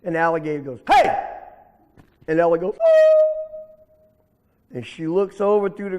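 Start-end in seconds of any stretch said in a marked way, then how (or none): 0.9-1.3 sudden loud, high "hey"; gruff call-out. Acted. Alligator startled awake calls out to rabbit. 2.8-3.3 very high, loud yelp; startled squeal. Acted. Little rabbit startled by the alligator.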